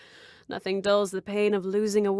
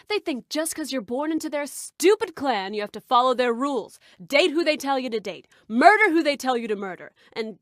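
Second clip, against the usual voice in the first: put-on worked-up hillbilly accent, getting louder; slipping into hillbilly accent; 2 semitones higher, 5 dB louder than usual. Ranting about the clan she left, hillbilly accent slipping out. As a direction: let the hillbilly accent take over as the rant builds. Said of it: "did I go back into the accent?".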